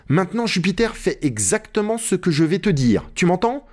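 high-pitched insistent instructions; Legrand, urgent master. Acted. Narrator voices Legrand giving orders. (Legrand, ordre insistant, voix aiguë)

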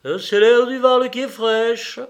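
high, loud, matter-of-fact complaint; same resigned tone. A narrator's reading. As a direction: blame the valley air, resigned. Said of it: "du même ton qu'il disait".

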